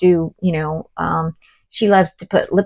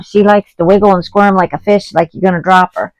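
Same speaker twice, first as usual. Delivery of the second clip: ordinary talk, nothing marked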